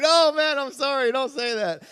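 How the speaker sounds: high, loud mock plea; retold pleading apology. Joker apologizing to the pastor he teased, pleading. Replaying his apology to friend.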